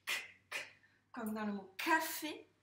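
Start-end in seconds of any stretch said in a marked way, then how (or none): 0.1-0.6 "k" as a bare voiceless click; isolated k sound. Shown as a demonstration. Teacher isolating the sound for a pupil, bare "k". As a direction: say "k" alone, no vowel after it. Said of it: "tu entends le son k".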